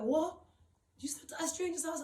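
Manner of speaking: high-pitched incredulous question, retold; shocked disbelief. Friend hears she slept at a stranger's, shocked disbelief. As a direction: act out a friend's shocked "what?".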